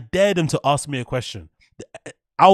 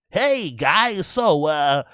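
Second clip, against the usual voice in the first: mock comedian questioning crowd; 3 semitones higher, slower than usual. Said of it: "he's asking you questions".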